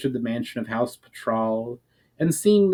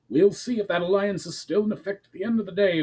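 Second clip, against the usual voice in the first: curt threat, pitched higher; barked; 8 semitones higher, faster than usual. A narrator's reading. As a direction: snap the warning back at him. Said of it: "barked Ramar".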